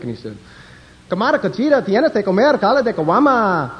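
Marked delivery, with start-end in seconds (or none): 1.1-3.7 high mock-Spanish patter in a Mexican accent. Second Mexican answering the stewardess, high mock Mexican Spanish. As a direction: rattle off comic Mexican Spanish, pitched high. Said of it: "They have all those words".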